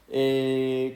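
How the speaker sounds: ordinary talk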